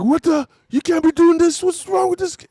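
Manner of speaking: high-pitched, outraged mock scolding; livid aunt. Aunt scolding over a nine-year-old out late, livid. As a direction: imitate an aunt losing it, voice jumping way up. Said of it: "my aunt was livid".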